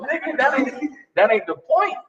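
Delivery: normal talking voice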